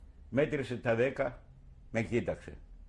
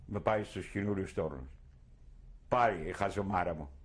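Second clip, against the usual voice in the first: normal talking voice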